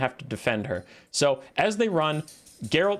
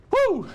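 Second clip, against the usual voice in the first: short, high-pitched woo; high whoop; 4 dB louder than usual. Real, not acted. Streamer gets back to the video game, high whoop. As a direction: let out a quick whoop to reset.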